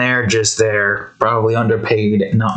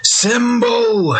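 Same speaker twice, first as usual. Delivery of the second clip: shouted correction; 9 semitones higher than usual. Shown as a demonstration. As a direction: yell "Symbol!" to correct the word. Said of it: "you fool".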